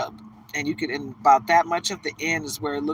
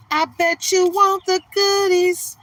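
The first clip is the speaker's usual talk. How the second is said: mock teasing voice; 12 semitones higher, 4 dB louder than usual. Mocking the ex who wants the goodies, high teasing voice. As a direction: taunt them like a sassy diva.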